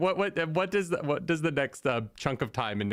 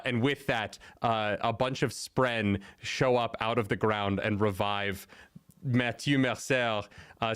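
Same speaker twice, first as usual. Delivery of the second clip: ordinary talk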